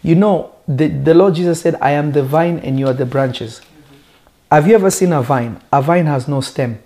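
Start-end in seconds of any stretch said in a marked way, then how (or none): none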